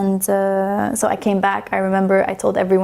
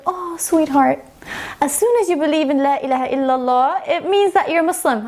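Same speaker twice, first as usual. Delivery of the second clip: sweet teacher; 6 semitones higher than usual. Retold or imitated. Teacher gently corrects new convert.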